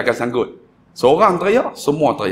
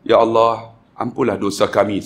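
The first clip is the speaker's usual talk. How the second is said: leading a supplication; 4 semitones lower than usual. Retold or imitated. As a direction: plead to God in a lowered, measured voice. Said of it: "Saya buat tentuhan". (doa merayu, perlahan dan rendah)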